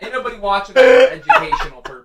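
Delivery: fast, high, laughing retort. Real, not acted. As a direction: keep laughing through the line. Still laughing at the donation.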